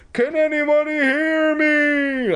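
high, slow desperate scream; screaming for help. Acted. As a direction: scream it through cupped hands. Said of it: "I screamed".

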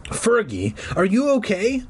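worried call lifting high at the end; tiny and shrill. A narrator's reading. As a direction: call out small, rising sharply on the question. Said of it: "My voice came out tiny and shrill".

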